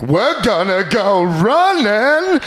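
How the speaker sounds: slow and simple like Forrest; Forrest Gump voice. Retold or imitated. Forrest answering the cardio coach, slow drawl. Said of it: "he was like".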